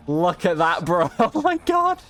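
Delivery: ordinary delivery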